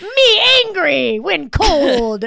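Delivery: slow, loud, high dumb caveman voice; caveman Google. Retold or imitated. Friend mocks the phone search as a caveman, slow and loud. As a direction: grunt it out like a caveman search engine. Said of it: "What is this, caveman Google?".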